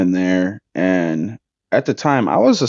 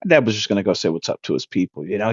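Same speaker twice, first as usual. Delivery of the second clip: usual speaking voice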